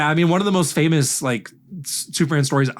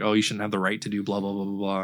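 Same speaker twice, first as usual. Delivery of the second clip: mocking quote; 7 semitones lower, 8 dB quieter than usual. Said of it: "because she's like".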